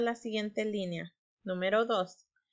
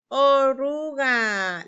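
"Oruga" drawn out slowly, higher and louder; 4 semitones higher, 6 dB louder, much slower than usual. Shown as a demonstration. Teacher answers her own question "Oruga", slow and loud. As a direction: call out "Oruga" big and slow. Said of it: "¿Cuál es la palabra?".

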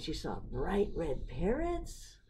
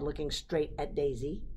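plain reading voice

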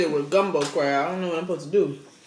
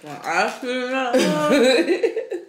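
laughing; 5 semitones higher, much slower than usual. Real, not acted. Laughing while retelling the line.

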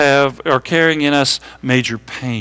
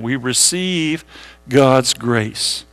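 slow and soft, spaced for writing; quiet, slow repeat to copy down; slow dictation; 3 semitones lower, 3 dB quieter, much slower than usual. Shown as a demonstration. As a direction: read it out slowly for notes. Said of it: "Write that down".

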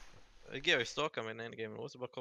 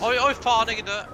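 startled high yell; panicked yelp; 9 semitones higher, 10 dB louder, faster than usual. Real, not acted. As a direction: yelp in panic as you die. Sudden death in game.

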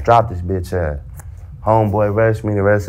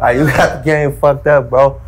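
defiant retort; 6 semitones higher, 5 dB louder than usual. Retold or imitated. Retold defiant reply to the guard.

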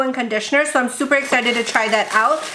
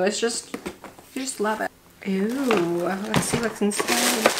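hushed fond murmur; 3 semitones lower, 6 dB quieter than usual. Real, not acted. Mint box, hushed.

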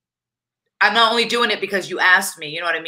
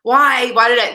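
imitating self-pity; 6 semitones higher, 4 dB louder, slower than usual. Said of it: "I would take that and be like".

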